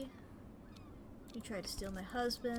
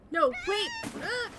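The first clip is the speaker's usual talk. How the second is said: high loud yelp cut off; panicked cry; 11 semitones higher, 6 dB louder than usual. Acted. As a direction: scream as you're pushed. Victim pushed overboard.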